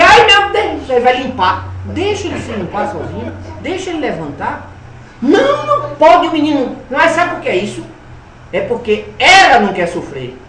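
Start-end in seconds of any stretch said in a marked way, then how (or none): 0.0-0.9 high, loud fussing mother's cry; mock-alarmed mother, much higher and louder; panicked mother. Mother panics at child's fall. 5.2-6.8 fussing mother. Retold or imitated. Mother's voice protecting the boy.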